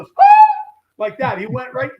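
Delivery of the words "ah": short high-pitched mock scream; imitated scream. Man greeting a friend in a mall, imitated scream. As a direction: imitate a man's sudden shriek. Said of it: "he screamed like that".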